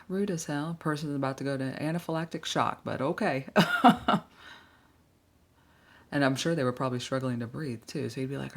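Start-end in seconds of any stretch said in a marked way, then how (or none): 3.5-4.2 loud, high burst of laughter. Real, not acted. Reacting to absurd story.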